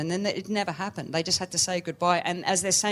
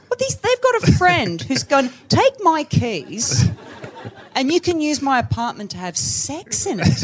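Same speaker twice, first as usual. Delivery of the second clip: lightly voiced Billy Wilder; 9 semitones higher, 7 dB louder than usual. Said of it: "and he's like".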